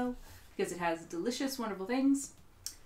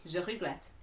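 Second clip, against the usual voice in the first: put-on softer, terrible French accent; sheepish attempt at a French accent; bad French accent; 6 dB quieter than usual. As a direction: offer French in a poor accent, quietly. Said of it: "It was terrible".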